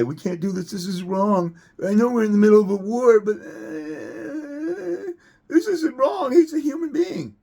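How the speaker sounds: mock the scared soldier as a blubbering crybaby; mock crying. Ranting dad mocks the movie's scared soldier, mock crying. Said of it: "Your little pussy like that one guy".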